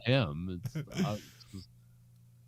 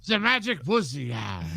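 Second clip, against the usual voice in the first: higher, laughing mock French accent; 8 semitones higher, 4 dB louder, slower than usual. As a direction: purr it like a cartoon Frenchman. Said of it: "I'm becoming French now".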